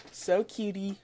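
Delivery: ordinary talk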